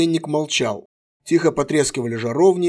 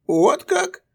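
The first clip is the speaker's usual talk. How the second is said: mocking; 13 semitones higher than usual. A narrator's reading. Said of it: "насмешливо переспросил патриарх".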